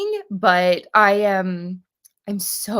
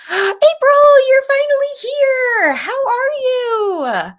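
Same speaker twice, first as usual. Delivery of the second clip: excited greeting; 9 dB louder than usual. Real, not acted. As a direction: squeal the hello to a friend who finally showed up. Gasps as a viewer arrives.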